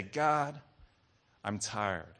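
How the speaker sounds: soft, low plea to God; tired prayer. Shown as a demonstration. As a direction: confide tiredness quietly in prayer. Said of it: "I encourage you to say".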